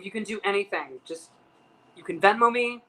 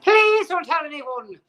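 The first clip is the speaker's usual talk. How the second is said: high, loud, drawn-out bad Irish-Scottish accent; hammed-up Irish accent; 8 semitones higher, 9 dB louder, slower than usual. Irish actress begging a fan, exaggerated Irish accent. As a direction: overdo the Irish accent, pitched way up. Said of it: "That's a really bad Irish slash Scottish accent".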